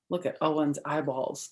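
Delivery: ordinary delivery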